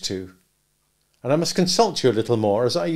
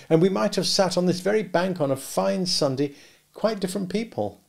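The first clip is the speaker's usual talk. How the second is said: higher and louder, longing, quickening toward the end; wistful regret; 5 semitones higher, 4 dB louder than usual. A narrator's reading. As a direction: build from regret to a pleading question. Pip voiced, rising and louder.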